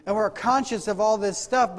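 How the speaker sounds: ordinary talk, nothing marked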